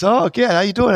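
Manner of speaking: put-on high, bright greeting as old teacher; imitating the shop teacher. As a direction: pitch up into the teacher's friendly hello. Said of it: "He goes".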